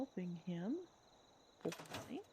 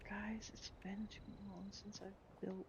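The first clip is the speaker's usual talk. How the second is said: whispering; 8 dB quieter than usual. Real, not acted. Confides to viewers while building.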